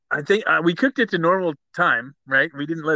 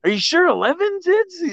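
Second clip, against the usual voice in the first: high, pleading mock whine; nagging stoner voice; 13 semitones higher than usual. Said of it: "kept coming in by me and going".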